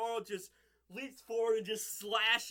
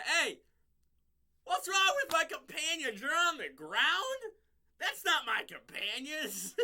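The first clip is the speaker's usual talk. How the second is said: mocking hero; 8 semitones higher, 6 dB louder than usual. Toro teases his defeated companions, high and mocking. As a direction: sneer at friends lying on the ground.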